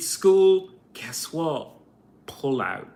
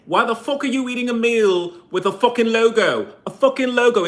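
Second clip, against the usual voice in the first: angry rant; 7 semitones higher, 4 dB louder, faster than usual. Real, not acted. Ranting at logo-branded meals.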